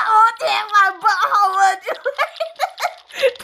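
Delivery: high, slow words broken by laughter; cracking up at the game's gross joke; laughing. Real, not acted. Streamer laughing through a gross game moment.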